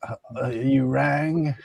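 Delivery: slow, mock butler; a creaky butler answering the bell. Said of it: "He'll be like".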